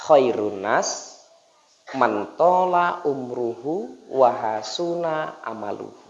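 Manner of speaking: slow, measured Arabic recital; solemn recitation. Acted. Preacher recites the hadith to the congregation, slow and solemn. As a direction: intone it like a sacred text.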